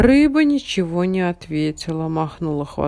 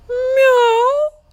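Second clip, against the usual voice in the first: cat meow; 7 dB louder than usual. A narrator's reading. Unknown meows.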